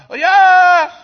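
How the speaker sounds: high, loud mock cry of delight; mock delighted exclaim. Preacher mocking an eager churchgoer's praise, high and loud. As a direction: toss out an eager church member's oh yes.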